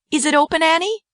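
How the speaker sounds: put-on very high eager child voice. A narrator's reading.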